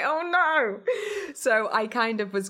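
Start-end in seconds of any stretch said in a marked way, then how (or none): 0.0-0.8 laughing dismay. Retold or imitated.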